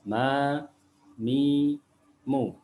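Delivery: "Ma, mi, mu" with each vowel held long; sustained, even vowels on "Ma, mi, mu"; long madd vowels. Shown as a demonstration. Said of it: "bacaan mat atau bacaan panjang".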